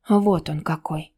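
plain reading voice

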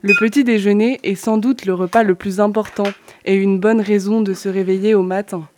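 ordinary talk, nothing marked

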